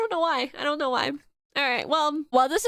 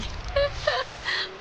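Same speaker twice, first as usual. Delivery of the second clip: breathy sob; 10 semitones higher, 4 dB quieter than usual. Real, not acted. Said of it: "I'm so sad".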